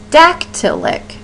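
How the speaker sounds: "dactylic" slowly, first syllable high and punched, two light after; stressed first syllable. Shown as a demonstration. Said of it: "A stressed syllable followed by two light syllables".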